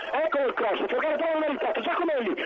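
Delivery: rising excitement on the attack; excited commentary. Acted. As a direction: voice climbing as the cross comes in. Rising play-by-play on a cross. (telecronaca in crescendo)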